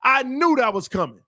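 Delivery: high-pitched frustrated replay of the play; exasperated rant. Real, not acted. Commentator rants about the missed touchdown, exasperated. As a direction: complain it was there for the taking.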